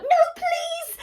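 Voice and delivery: high, loud desperate scream; terrified scream. Acted. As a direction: scream for mercy. Girl screams for her life.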